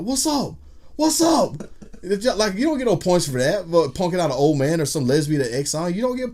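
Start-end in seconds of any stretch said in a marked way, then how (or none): 0.0-1.6 high, loud confrontational what's up; mock street challenge yelled; challenging shout. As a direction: square up and call it out. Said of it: "turned around and be like".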